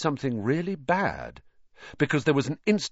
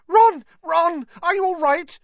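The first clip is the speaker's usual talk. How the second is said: very high, loud, alarmed squealing; piercing cry of alarm; 8 dB louder than usual. A narrator's reading. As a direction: squeal it in panic. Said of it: "squealed Hermione".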